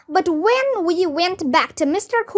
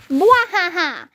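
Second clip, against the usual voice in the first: higher hammed-up villain cackle, falling; evil supervillain laugh; 4 semitones higher than usual. A narrator's reading. Doctor Floss as supervillain, acted evil laugh. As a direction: spell out a mock evil laugh. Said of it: "in an evil supervillain voice".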